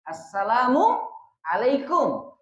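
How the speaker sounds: Assalamualaikum said slowly in one unbroken breath; slow connected demo. Shown as a demonstration. Teacher shows joined greeting, slow and unbroken. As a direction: join Assalamualaikum smoothly, no gap. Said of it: "Menyambung dua kalimat tadi".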